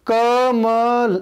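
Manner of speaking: slow demo. Shown as a demonstration. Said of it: "ल पे रुक गई है".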